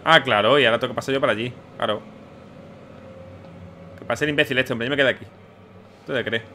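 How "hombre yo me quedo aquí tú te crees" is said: normal talking voice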